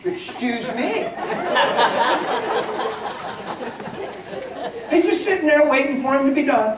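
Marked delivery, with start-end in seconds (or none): 0.0-1.1 mock polite. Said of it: "the Lord's not like". 1.1-4.9 real laughter, higher; high laugh after the joke; laughing at own joke. Real, not acted.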